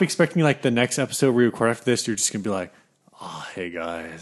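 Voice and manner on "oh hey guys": very soft, slow, lazy greeting; super relaxed. Retold or imitated. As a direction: sound totally chilled out. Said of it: "Hey, guys, I'm super relaxed".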